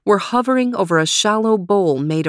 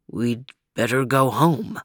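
low, soft, heavy character voice; deep, hushed resignation; subdued suggestion; 7 semitones lower, 4 dB quieter than usual. A narrator's reading. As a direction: say it low and quietly, after a stunned silence. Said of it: "said Overton finally".

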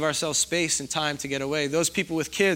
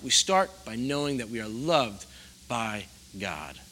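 ordinary talk, nothing marked

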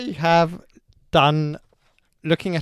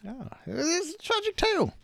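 mock tragic; 14 semitones higher, 4 dB quieter, slower than usual. Mock lament over dead dog.

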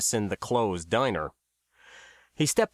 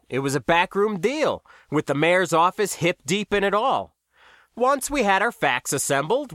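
higher-pitched, self-satisfied explainer; smiling New Guy; 6 semitones higher than usual. A narrator's reading. New Guy tells how he found the gossip, smiling and higher-pitched. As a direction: explain it knowingly through a smile.

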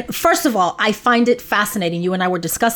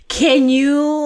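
ordinary talk

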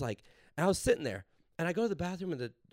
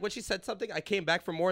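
normal talking voice

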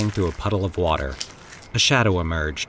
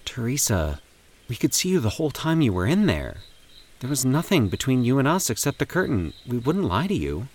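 consoling; 5 semitones higher than usual. A narrator's reading. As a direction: reassure her gently. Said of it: "Andy said consolingly".

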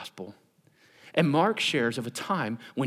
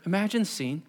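ordinary delivery

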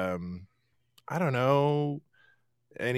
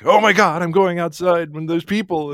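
high loud mock alarm at going out; mock panic; 6 semitones higher, 7 dB louder than usual. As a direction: gasp it like going outside is terrifying. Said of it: "That was like".

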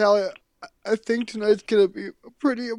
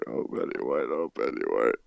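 much lower, softer hammed-up moan; a deep theatrical groan of mourning; mock groaning lament; 9 dB quieter than usual. Mock grieving, repeated lament.

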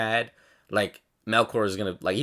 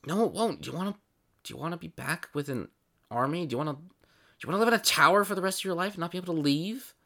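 higher-pitched pleading character voice; worried cautioning character; 9 semitones higher, faster than usual. Acted. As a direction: press them with anxious questions. Game master argues in character.

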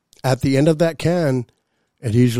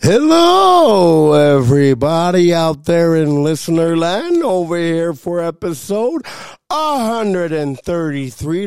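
high, bright podcast host greeting; radio host intro; 4 semitones higher, 4 dB louder, slower than usual. Acted. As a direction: welcome the listeners like a radio host.

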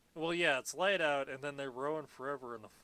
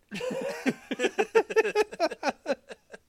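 big high laugh; 11 dB louder than usual. Real, not acted. Cracking up at own joke.

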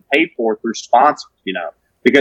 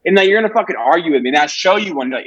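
normal talking voice

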